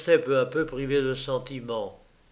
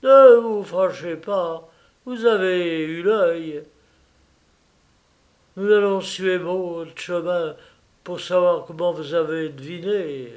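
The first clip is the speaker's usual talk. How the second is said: louder, unhurried answer; cool thief; 4 semitones higher, 6 dB louder than usual. Acted. Raffles answers the armed millionaire, cool and unhurried. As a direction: play it cool under the gun.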